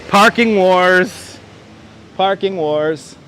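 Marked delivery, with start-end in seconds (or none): 0.1-1.4 slow, drawn-out, louder announcer read; dramatic announcement. Pretend. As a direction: boom it out like a show title. Reading a sign aloud, dramatically.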